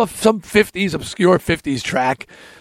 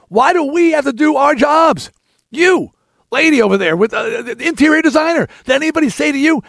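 Jerry Seinfeld impression; 8 semitones higher than usual. Joking to the wedding crowd, Seinfeld-style comic whine. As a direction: stretch each question like a stand-up bit. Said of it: "now I go into my best Jerry Seinfeld".

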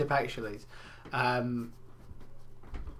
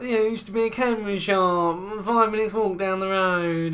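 put-on weird old customer; 6 semitones higher, 5 dB louder than usual. Odd customer giving shop staff directions, weird stretched voice. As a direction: pitch it up and stretch the last words. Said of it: "that's what his voice is like".

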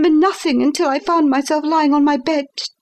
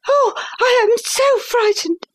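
put-on higher, breathless frightened girl; panting fright; 11 semitones higher than usual. A narrator's reading. Governess caught in the passage, frightened and panting. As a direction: gasp it out, much higher. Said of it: "I panted".

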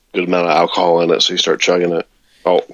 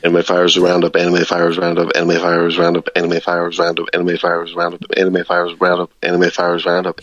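rapid repetition. Shown as a demonstration. Co-host taking the say-it-ten-times-fast dare, rapid repetition. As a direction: rattle it off over and over in a monotone. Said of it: "Say it ten times fast".

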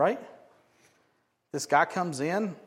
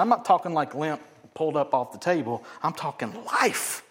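ordinary talk, nothing marked

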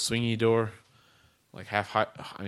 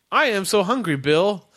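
high loud robot chat; 8 semitones higher, 4 dB louder than usual. Acted. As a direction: stiff machine small talk, pitched up. Robot chats.

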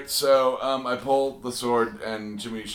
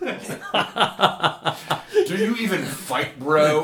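gym bro voice; 6 semitones higher, 5 dB louder than usual. Acted. Gym-bro retort in character.

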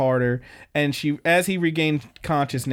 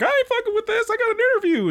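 much higher, squeaky bailing-out voice; panicky old guy; faster than usual. Retold or imitated. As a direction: squeal it like a scared old man running off. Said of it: "He's like".

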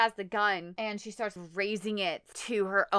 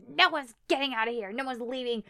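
higher put-on voice of refusal; menacing wife; 4 semitones higher, faster than usual. Wife with a gun forbids leaving, raised mock-menacing voice. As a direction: imitate her unhinged command, pitched up. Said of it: "she's all like".

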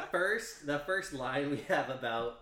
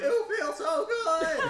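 high, slow, hammed-up Seinfeld voice; a Seinfeld voice, higher, slow and laughing; Jerry Seinfeld impression; 9 dB louder, much slower than usual. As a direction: ham up a Seinfeld whine, drawn out. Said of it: "He could do Jerry Seinfeld to a T".

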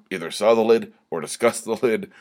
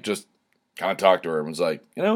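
normal talking voice

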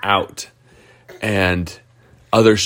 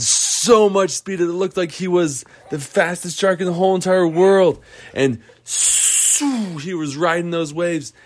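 much higher, rising to a gleeful cheer; thrilled narration; 9 semitones higher than usual. A narrator's reading. Storyteller building the race finish to a child, much higher and thrilled. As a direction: build the finish like a sports call.

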